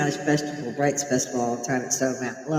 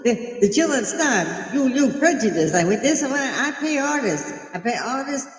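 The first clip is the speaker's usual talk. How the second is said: raised, higher mocking voice; hammed-up mock protester, higher; mock yelling protesters; 8 semitones higher than usual. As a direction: lampoon them, voice pitched up. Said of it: "And they're yelling".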